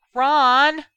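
very loud, high-pitched shout; yelling. A narrator's reading. Sister summoning her brother from another room, yelling. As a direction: bellow the name down the hall.

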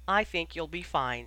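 plain reading voice

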